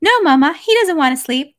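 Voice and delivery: put-on high, loud little girl, sure of herself; Natasha, with conviction. A narrator's reading. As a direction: contradict Mama in a small child's voice. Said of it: "little Natasha answered with conviction".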